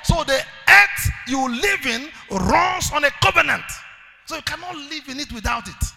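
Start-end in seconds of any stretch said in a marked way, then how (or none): 0.0-3.7 loud, slow, higher declaration; rousing call-and-response; preaching at full force. Acted. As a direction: thunder it out for them to repeat.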